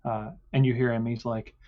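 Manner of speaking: ordinary talk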